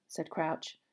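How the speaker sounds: plain reading voice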